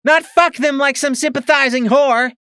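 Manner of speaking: high, loud furious yell. A narrator's reading. Mare yelling at Twist about the war, furious. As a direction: rage at her, every word a shout.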